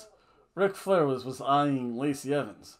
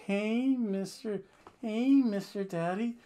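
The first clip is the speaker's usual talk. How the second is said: flirty Lacey; 7 semitones higher, slower than usual. Retold or imitated. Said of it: "Lacey Evans goes out".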